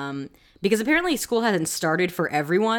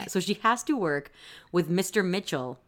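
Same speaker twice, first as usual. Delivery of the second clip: ordinary delivery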